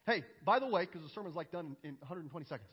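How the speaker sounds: ordinary talk, nothing marked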